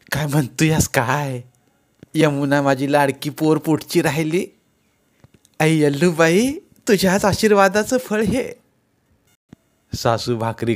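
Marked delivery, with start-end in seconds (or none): 0.1-8.5 put-on overjoyed old mother. Mother hearing she'll be a grandmother, higher and delighted. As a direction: thank the goddess, overjoyed.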